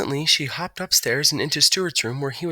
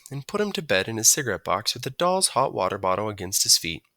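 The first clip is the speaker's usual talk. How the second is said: plain reading voice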